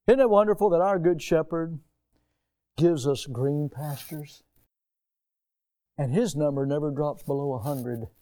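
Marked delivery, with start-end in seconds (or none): none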